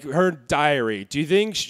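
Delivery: ordinary talk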